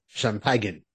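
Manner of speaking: "champagne" said as sham-PAG-en; Zapp Brannigan's champagne. Retold or imitated. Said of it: "as, uh, Zach Flanagan would call it".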